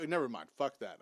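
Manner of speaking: ordinary talk, nothing marked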